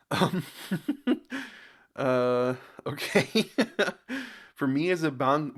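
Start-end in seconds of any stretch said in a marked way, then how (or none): 0.5-1.5 high, stifled chuckle; amused chuckle. Real, not acted. Amused by comment he read. 3.5-4.4 high, bubbling little laugh; amused chuckle. Real, not acted. Amused by a baffling comment.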